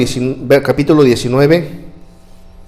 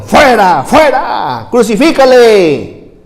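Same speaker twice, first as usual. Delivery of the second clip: high, loud shouts; crowd yelling; 9 semitones higher, 6 dB louder, much slower than usual. Retold or imitated. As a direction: yell like a furious crowd. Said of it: "ellos gritaron". (gritos de la multitud, agudos y fuertes)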